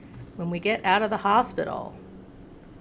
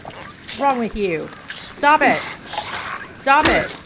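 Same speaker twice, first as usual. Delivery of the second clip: loud, higher frantic shouts; louder alarmed protest; panicked yelling; 5 semitones higher, 8 dB louder than usual. Real, not acted. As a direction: yell at it to let go. Enemy biting her foot.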